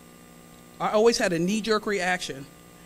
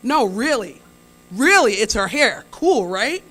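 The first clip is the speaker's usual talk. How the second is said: eager customer; 2 semitones higher, 8 dB louder, slower than usual. Retold or imitated. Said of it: "the other customer is in on the conversation".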